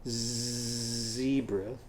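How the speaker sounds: zebra drawn out softly to isolate the z; a teacher isolating the first sound; soft stretched zebra. Shown as a demonstration. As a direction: ease into the opening z. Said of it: "what's the first sound in zebra".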